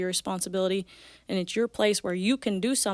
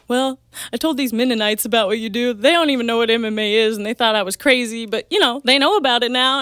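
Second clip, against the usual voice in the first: imitating dad bragging, louder and animated; 3 semitones higher, 7 dB louder than usual. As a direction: boast to everyone like a proud father. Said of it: "but he brags to everyone".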